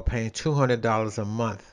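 usual speaking voice

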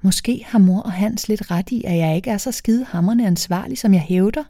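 usual speaking voice

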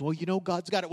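soft, offhand imitation of a weak believer; tossing off the phrase without conviction; mock resigned shrug. Preacher mimics believers in a soft, limp way. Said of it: "we say that sometimes".

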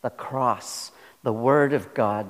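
ordinary delivery

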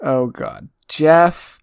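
usual speaking voice

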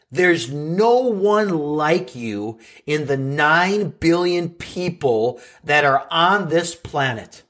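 slow, each word weighed; slow earnest emphasis. Acted. Podcast host tells listeners they are unique, slow and earnest. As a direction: lay it down slowly for listeners.

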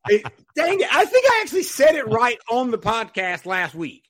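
loud, high-pitched comic frustration; exasperated protest. Real, not acted. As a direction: protest loudly at your own slip. Botched guest's name, protests good-naturedly.

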